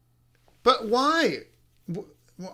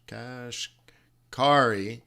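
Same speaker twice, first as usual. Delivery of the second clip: Kashkari stretched out very slowly; drawn-out pronunciation; 6 semitones lower, 3 dB quieter, much slower than usual. Shown as a demonstration. Slowly spelling out Kashkari for the viewers, drawn out. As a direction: drag out every sound of Kashkari. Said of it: "You guys are gonna laugh".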